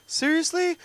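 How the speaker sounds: high, loud disbelief; shocked secretary. Retold or imitated. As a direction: squeak it out in shock.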